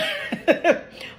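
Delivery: short high burst of laughter. Real, not acted. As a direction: laugh at herself. Laughs at own word mix-up.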